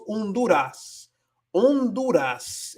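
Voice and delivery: Honduras as on-DOO-ras, H silent, drawn out; Spanish pronunciation. Shown as a demonstration. Teacher shows the Spanish name, slow silent-H Honduras. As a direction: cut the H, stretch Honduras with Spanish vowels. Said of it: "de la H porque es muda. Entonces decimos".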